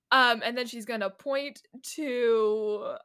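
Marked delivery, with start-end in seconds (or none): none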